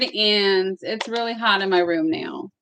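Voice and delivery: ordinary talk, nothing marked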